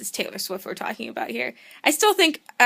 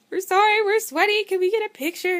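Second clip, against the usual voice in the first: much higher and louder, breathless gushing; excited fangirl plea; 10 semitones higher, 5 dB louder than usual. Retold or imitated. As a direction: squeal it like a starstruck fan. Fans gushing at guitarist.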